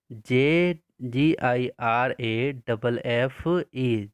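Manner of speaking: slow, spaced-out letters for copying; slow dictation spelling. Shown as a demonstration. Teacher dictating spelling slowly to pupils. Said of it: "तो हम लोग लिखेंगे".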